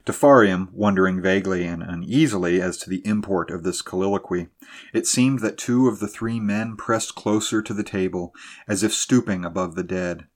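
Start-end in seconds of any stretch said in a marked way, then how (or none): none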